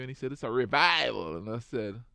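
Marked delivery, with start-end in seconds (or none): 0.3-1.4 louder quoted announcement; proclaiming. Retold or imitated. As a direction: announce it with emphasis on the last word. Quoting acquaintance announcing the event.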